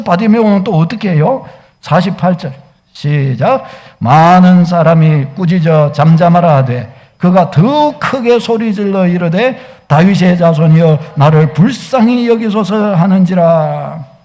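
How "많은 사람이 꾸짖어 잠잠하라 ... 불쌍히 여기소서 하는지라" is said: low, measured unison-reading voice; solemn recitation, low and even; congregational scripture reading. Real, not acted. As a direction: read the verse out firmly, drawing out each phrase. Reads verse with congregation.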